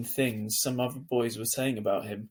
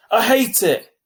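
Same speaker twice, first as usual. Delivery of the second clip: sudden loud angry cry, much higher; angry outburst; 10 semitones higher, 12 dB louder, slower than usual. A narrator's reading. Boy suddenly furious about school, yelling high. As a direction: burst out in fury. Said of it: "He was angry all of a sudden".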